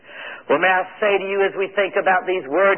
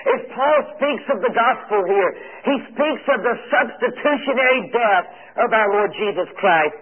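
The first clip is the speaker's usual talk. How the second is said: ordinary talk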